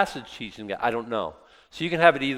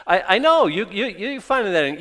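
incredulous; 8 semitones higher, 6 dB louder than usual. Acted. Mimicking incredulous listener.

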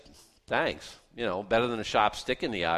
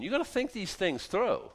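ordinary delivery

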